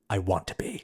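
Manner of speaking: lightly mimicked hushed intensity; hushed acted quote. Quoting the girl's close-up line, hushed imitation. Acting out a tight close-up moment.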